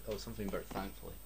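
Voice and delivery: plain reading voice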